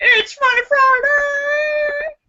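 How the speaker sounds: high, slow crying sing-song; show opener. Real, not acted. Reintroducing Funny Friday to viewers, laughing sing-song. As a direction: wail the show's name through laughter. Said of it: "repeats show opener".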